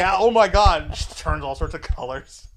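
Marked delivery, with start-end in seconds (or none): 0.0-0.8 loud, higher imitated outburst; mock mortified yell. Girlfriend caught out about mom's call, mortified yell. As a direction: blurt it loudly in mock horror.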